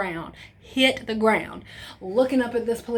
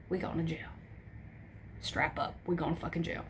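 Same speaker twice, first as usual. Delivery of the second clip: low, soft resigned mutter; resigned doom; 4 semitones lower, 12 dB quieter than usual. Retold or imitated. Teen mutters to herself spotting the whiskey, low and doomed. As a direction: say it like it's already over. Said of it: "I'm like".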